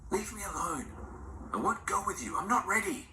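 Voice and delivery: high, breathless whispered plea; a frightened grandmother pleading not to go; terrified pleading. A narrator's reading. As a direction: beg not to be taken. Grandmother refuses in fear.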